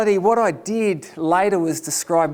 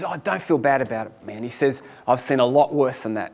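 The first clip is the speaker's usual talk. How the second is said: reassuring operator; 7 semitones lower than usual. Retold or imitated. Operator consoling the teller.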